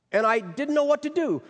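high, pleading, near tears; distressed. Retold or imitated. Juanita's distress peaks, retold.

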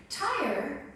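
normal talking voice

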